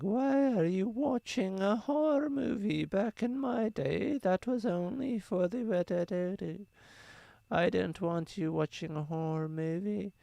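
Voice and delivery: put-on high, slow old granny voice; quavery old grandma scolding; grandmother voice. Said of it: "how good your grandmother voice is".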